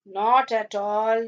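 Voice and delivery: very slow, stretched vowels; drawn-out polite dismissal. Acted. Host answering the doctor's apology for wasted time, drawn-out. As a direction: draw each phrase right out, reassuringly.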